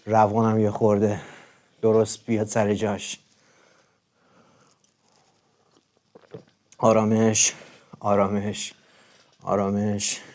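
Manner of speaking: very soft, low, hushed self-soothing chant; mock calming meditation. Calming himself before his live viewers, hushed mock meditation. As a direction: lull yourself down, half joking. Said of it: "یه خورده آروم باش [name]".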